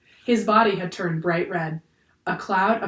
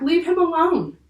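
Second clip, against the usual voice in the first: high, loud protest; yelled demand; 11 semitones higher, 6 dB louder than usual. A narrator's reading. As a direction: shout to defend him. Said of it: "yelled Jack".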